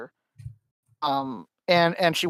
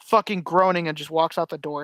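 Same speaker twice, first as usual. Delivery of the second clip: ordinary talk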